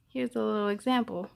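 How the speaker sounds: usual speaking voice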